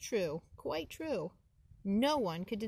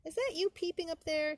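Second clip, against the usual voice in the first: a higher, pushy question; demanding possum; 9 semitones higher than usual. Acted. Lightly voiced possum calling up to the catbirds. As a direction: demand an answer from the birds. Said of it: "demanded Possum".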